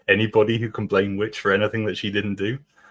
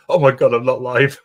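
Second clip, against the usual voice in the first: louder, flustered realization; mock panic; 4 semitones higher, 9 dB louder than usual. Friend imitating her panic at not being live. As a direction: blurt it out as if caught not streaming. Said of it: "she's like".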